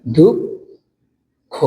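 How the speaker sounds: দুঃখ said very slowly with a doubled kh; দুঃখ slowly, doubled kh sound; drawn-out dukkho. Shown as a demonstration. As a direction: stretch দুঃখ, holding the kh. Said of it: "খ দুইবার লিখবো".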